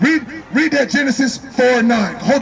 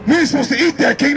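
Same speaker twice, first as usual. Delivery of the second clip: ordinary talk, nothing marked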